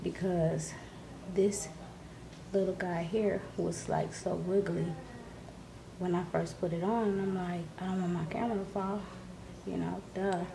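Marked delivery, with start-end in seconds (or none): none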